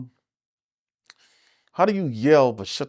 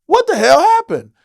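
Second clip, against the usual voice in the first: amazed, raised voice; 8 dB louder than usual. Retold or imitated. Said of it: "you was a whole different beast today".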